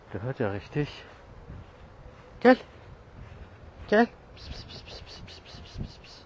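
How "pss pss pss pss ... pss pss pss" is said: soft voiceless pss sounds, fading; cat-luring hiss. Real, not acted. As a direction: hiss softly to lure a cat. (leises Locken mit Zischlauten)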